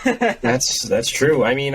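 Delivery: usual speaking voice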